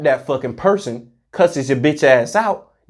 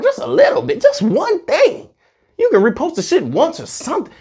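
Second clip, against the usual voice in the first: squeaky pleading; 13 semitones higher than usual. Real, not acted. Pleading for a little support.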